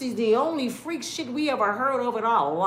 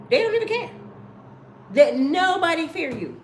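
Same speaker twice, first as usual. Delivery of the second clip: ordinary talk, nothing marked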